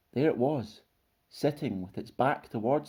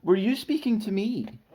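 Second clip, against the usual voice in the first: lightly voiced high, polite monster; not at all monstrous; 10 semitones higher, 4 dB louder than usual. A narrator's reading. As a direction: ask politely, pitched up. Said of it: "not at all monstrous".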